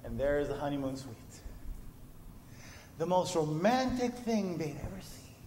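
usual speaking voice

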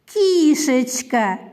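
Кішечка as one word with a long, drawn-out first vowel; slow, connected Кішечка, lingering on the start; affectionate diminutive. Shown as a demonstration. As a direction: stretch the Кі in Кішечка slowly without breaking the word. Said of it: "Назвіть її ласкаво".

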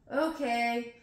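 normal talking voice

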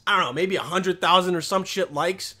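ordinary talk

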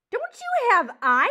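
high, very loud screech. Acted. Porcupine insulted by mouse's question, screeching. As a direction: screech it, stretching the words. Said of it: "Aerith screeched".

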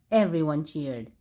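plain reading voice

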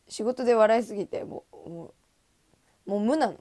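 ordinary delivery

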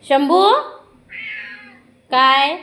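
high, loud pet call. Real, not acted. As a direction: call out sharply high to get the pet's attention. Calling to Shambhu at the door.

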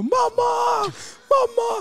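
very high, loud imitation of wailing children; kids screaming for mum. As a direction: mimic frightened kids shrieking for their mother. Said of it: "Og de kidsa bak meg også". (etterligner barn som hyler høyt)

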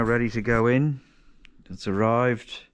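normal talking voice

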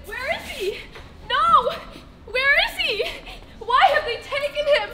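high-pitched panicked searching, building to loud anguish; frantic mourner demanding answers; distraught. Acted. Acted grief at the empty tomb.

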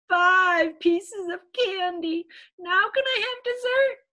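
high, loud little-pea voice; pleading child. Acted. Little P done with candy, asking for dessert, high plea. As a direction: push it up high, stretched then eager.

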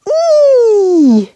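"E" held very long as "ee", high then falling; long French E. Shown as a demonstration. Contrasting French with English for learners, long high "E". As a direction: draw "E" out into a high "ee". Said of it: "Can you make that sound?".

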